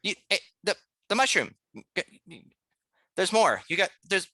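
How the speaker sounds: high, stammering mouse voice; manic little mouse. Acted. Mouse motions the player toward mushrooms, manic and halting. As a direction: stumble excitedly over the words as a tiny mouse. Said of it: "tiny little body of a mouse".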